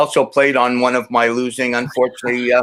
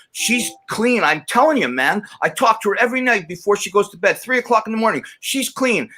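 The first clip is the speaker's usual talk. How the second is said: high-pitched heated protest, acted out; retold mad insistence; 9 semitones higher than usual. Telling Gooch back then that she's clean, mad and insistent. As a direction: reenact insisting against all doubt, pitch jumping up. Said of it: "I got mad at you".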